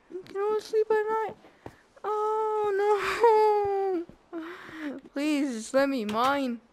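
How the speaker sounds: high, loud, fast frightened whine; frightened pleading. Real, not acted. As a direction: beg the mobs to leave you alone. Night mob attack, pleading.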